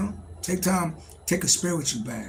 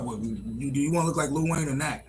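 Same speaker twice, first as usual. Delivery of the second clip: usual speaking voice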